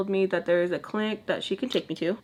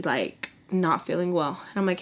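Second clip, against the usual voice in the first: ordinary talk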